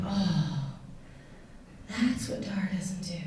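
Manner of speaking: whispered realization; soft whispered aside; hushed inner thought. Retold or imitated. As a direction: breathe it out as a private thought.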